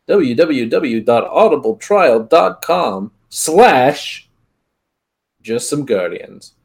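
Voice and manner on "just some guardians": normal talking voice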